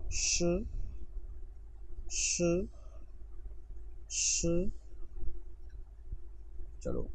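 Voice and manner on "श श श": श said short and soft, not शी; correct Chinese sound. Shown as a demonstration. Teacher correcting a common student mistake, careful. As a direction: clip it to श with no ee vowel. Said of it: "repeat करें मेरे साथ तीन मरतबा".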